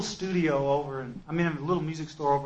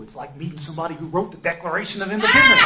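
excited fan; 3 semitones higher, 6 dB louder, faster than usual. Retold or imitated. Excited fan meeting the author.

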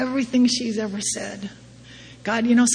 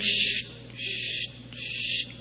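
high squeaky dolphin clicks, breathy shh; dolphin squeaks; 10 dB quieter, much slower than usual. Retold or imitated. Imitating the dolphins at the beach with high squeaks.

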